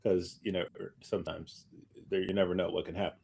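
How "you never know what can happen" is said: ordinary talk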